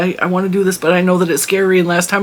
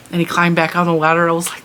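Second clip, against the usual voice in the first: usual speaking voice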